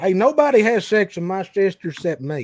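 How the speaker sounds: mock hillbilly. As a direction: play a possessive hillbilly for laughs. Mock hillbilly boast after joke.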